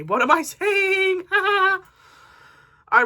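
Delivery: high, slow giggling self-correction; laughing at herself. Real, not acted. Booktuber laughs after misstating what she read. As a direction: laugh through catching your own mistake.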